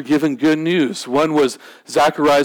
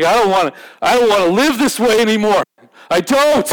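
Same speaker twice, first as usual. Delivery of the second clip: acted desperate plea, rising higher; breaking down; 6 semitones higher, 3 dB louder than usual. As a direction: build to a pleading cry from a broken man. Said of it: "they broke down".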